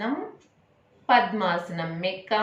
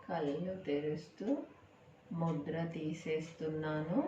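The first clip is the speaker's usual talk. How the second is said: guided meditation close; 2 semitones lower, 14 dB quieter than usual. Acted. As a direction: gently bring them back from the breathing. Bringing listeners out of meditation. (నెమ్మదిగా మృదువైన ధ్యాన స్వరం)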